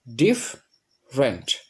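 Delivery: ডিফারেন্ট broken slowly into "dif... rent"; syllable by syllable. Shown as a demonstration. Teacher showing two syllables, ডিফারেন্ট split slowly as "dif... rent". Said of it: "এখানে দুইটা syllable বিদ্যমান".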